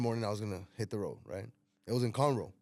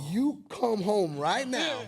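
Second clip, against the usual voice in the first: slow, higher order through laughter; mock stern order; 8 semitones higher, 4 dB louder, much slower than usual. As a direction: order him home, laughing. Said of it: "I say".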